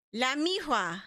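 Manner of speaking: "miroir" said "mee-hwah"; "La miroir" as "lah mee-HWAH"; French-style R as H. Retold or imitated. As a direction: copy the French, "miroir" with a breath of H for the R. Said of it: "sounds like there's an H in there".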